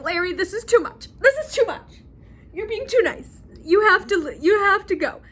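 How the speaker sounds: high, rising flustered wife imitation; flustered protest. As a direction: push him away in a playful high, loud voice. Wife protests at husband's sweetness.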